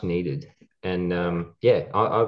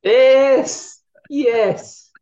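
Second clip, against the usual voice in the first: a loud, much higher burst of delight; thrilled shout; 10 dB louder than usual. Real, not acted. Cohost hears the tag team name, shouts with delight. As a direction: shout yes like you just heard the best news.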